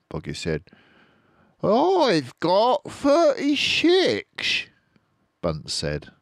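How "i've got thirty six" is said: very slow high boast; squeaky dwarf boast. Acted. Bunce boasting to the others, slow squeaky. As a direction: drag out the boast.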